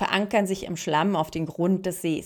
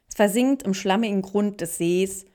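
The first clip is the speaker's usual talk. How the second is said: usual speaking voice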